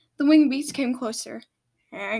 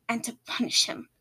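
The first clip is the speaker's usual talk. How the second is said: snarled. A narrator's reading. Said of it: "Trench snarled".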